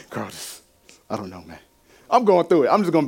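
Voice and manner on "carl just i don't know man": very soft, low and helpless; subdued, at a loss. Retold or imitated. Man breaking down to his friend Carl, hushed and lost. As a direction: let it drop almost to nothing.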